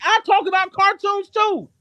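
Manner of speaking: real indignant retort in high pitch; exasperated retort. Real, not acted. Loud retort to the mimicked excuse.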